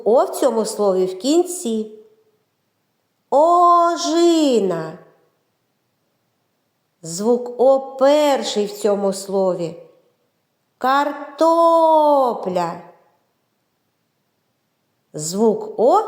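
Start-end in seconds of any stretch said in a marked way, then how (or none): 3.3-5.0 Ожина with a long, high opening О; виділений звук О. Shown as a demonstration. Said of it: "Визначимо місце звука О у цих словах". 10.8-12.7 Картопля with the middle О held long and raised; виділений звук О. Shown as a demonstration. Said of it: "Визначимо місце звука О у цих словах".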